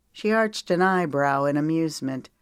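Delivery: plain reading voice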